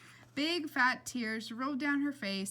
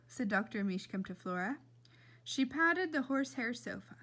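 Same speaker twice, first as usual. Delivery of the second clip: usual speaking voice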